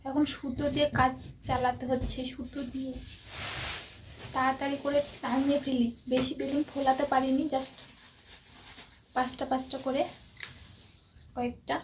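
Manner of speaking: hushed voice. Real, not acted. Secret birthday prep, hushed so sister can't hear. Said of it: "কথা বলতে পারছি না, দিদি শুনতে পাবে".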